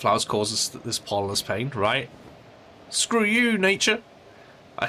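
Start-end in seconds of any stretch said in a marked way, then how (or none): 2.9-4.0 high mock outburst; shouted at nature. Real, not acted. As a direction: yell it up at the sky. Yelling at nature over hay fever.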